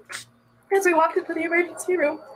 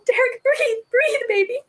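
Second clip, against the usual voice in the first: high, tearful pleading shout; mother yelling to her baby from the driver's seat; retold yell; 7 semitones higher, slower than usual. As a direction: beg the baby to breathe, voice cracking. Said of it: "I would just yell".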